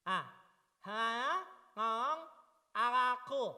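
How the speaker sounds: talking with tongue held out; tongue stuck out. Shown as a demonstration. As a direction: say it with your tongue pulled out. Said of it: "semua lidahnya keluarin terus ngomong". (bicara sambil menjulurkan lidah)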